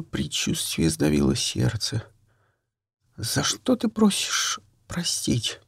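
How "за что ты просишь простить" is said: hushed, breathless question; whisper. Acted. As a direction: whisper it in dread.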